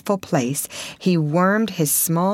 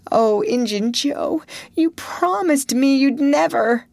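despairing exclamation; 10 semitones higher than usual. A narrator's reading. Potter crying out to Injun Joe, high and despairing. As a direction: plead with the man who betrayed you.

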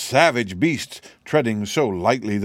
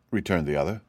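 ordinary delivery, nothing marked

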